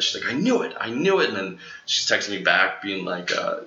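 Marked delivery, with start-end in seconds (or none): 0.3-1.3 high-pitched, thrilled retold exclamation; super excited. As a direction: imitate an excited friend, voice leaping up. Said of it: "got super excited".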